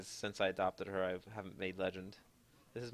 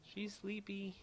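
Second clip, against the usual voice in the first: talking to a dog; 13 semitones higher, 4 dB quieter, slower than usual. Real, not acted. Cooing about sleeping puppy.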